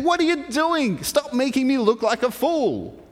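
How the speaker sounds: high-pitched mock yell; angry shouting. Balaam shouting at his donkey after it crushed his foot, angry. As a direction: scold in exasperation, voice jumping up. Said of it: "Balaam starts shouting at his donkey".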